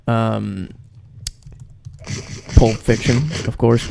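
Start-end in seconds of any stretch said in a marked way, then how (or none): none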